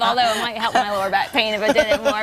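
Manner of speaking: laughing through the words. Real, not acted. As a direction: chuckle while you answer. Laughing off co-host's teasing.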